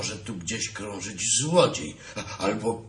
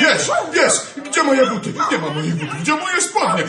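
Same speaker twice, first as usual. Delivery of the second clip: głośny, wysoki okrzyk przerażenia; krzycz, że cię okradli; alarmed shout; 13 semitones higher, 7 dB louder, much faster than usual. Acted. Tata budzi się okradziony. (tata krzyczy o skradzionych spodniach)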